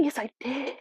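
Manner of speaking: unvoiced whisper, cheeky; whispered aside. Real, not acted. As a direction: whisper it like a confession to yourself. Whispered self-confirmation.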